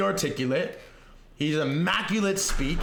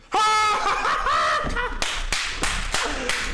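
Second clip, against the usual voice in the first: triumphant shouting laugh; 14 semitones higher than usual. Real, not acted. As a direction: burst out laughing in victory. Hits ten K subs.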